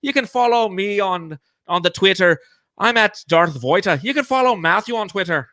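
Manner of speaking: high, lilting mock Irish accent. Podcast host plugging Suns Jam socials, mock Irish accent. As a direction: put on a hammed-up Irish accent. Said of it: "Let's do an Irish accent".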